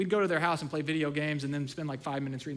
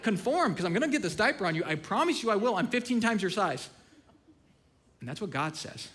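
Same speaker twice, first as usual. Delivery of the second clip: usual speaking voice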